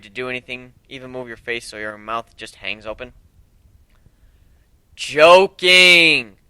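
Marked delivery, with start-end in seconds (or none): 5.0-6.4 playful reveal. Acted. Revealing the joke.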